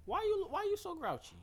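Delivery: high, soft voice for the dog; pet talk. Real, not acted. To the growling dog, high, gentle pet talk. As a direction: coo at the grumpy dog.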